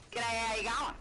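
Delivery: Australian accent, words run together in a cheery call; slurred Aussie greeting. Shown as a demonstration. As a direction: toss it off like a broad Aussie mate, all slurred. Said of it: "we kind of shorten all of our words and slur everything together".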